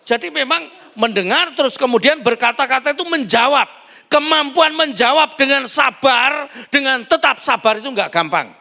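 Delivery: loud, high, driving sermon; preaching at full force. Acted. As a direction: push the point home to the crowd. (berkhotbah dengan suara keras dan tinggi)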